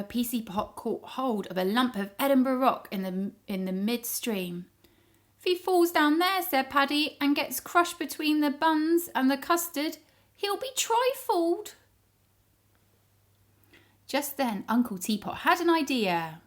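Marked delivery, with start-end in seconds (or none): 5.4-11.7 higher, louder worried voice; anxious Paddy. Acted. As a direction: fret about the custard fall.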